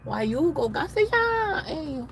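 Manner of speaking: high-pitched whiny play voice; mock complaint. Asking the policeman about the gas station, mock complaining.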